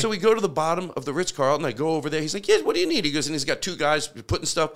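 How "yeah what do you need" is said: high-pitched mock English accent. Hotel manager offering supplies, imitated English accent. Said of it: "he had an English accent".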